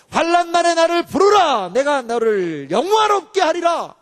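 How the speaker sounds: high, forceful pulpit declamation; fervent sermon delivery; impassioned preaching. Real, not acted. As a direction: proclaim it, stretching the key words.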